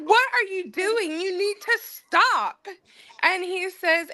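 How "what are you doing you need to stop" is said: high-pitched exasperated telling-off; retold scolding. Wife retelling how she told her husband to stop, scolding.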